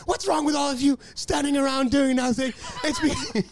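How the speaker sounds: high-pitched mock tirade; hammed-up outraged complaint; imitating indignant man. Acting out the man's indignant tirade.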